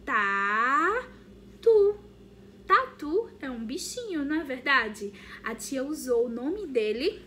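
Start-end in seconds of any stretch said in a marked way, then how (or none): 0.1-2.0 slow, loud teacher word demo; loud drawn-out word. Shown as a demonstration. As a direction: say the word long and loud for the kids. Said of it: "Vocês estão vendo esse nome?".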